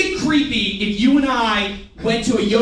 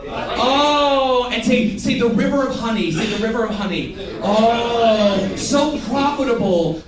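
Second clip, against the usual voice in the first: dreamy rapture; 3 semitones higher, 4 dB louder, slower than usual. Pretend. Garden of Eden fantasy.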